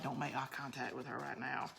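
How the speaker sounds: low, very soft retold warning; hushed warning. Husband warning the girls about angry wife, hushed imitation. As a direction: drop right down as if not to be overheard.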